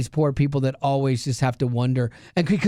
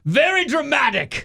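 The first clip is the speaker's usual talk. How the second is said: dramatic Meatloafian voice; 10 semitones higher than usual. Pretend. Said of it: "it's my Meatloafian voice".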